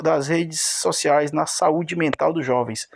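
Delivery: ordinary talk, nothing marked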